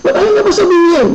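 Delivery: itching to know the secret; eager wondering. Retold or imitated. Worker wondering what the friend's secret is, high and quick.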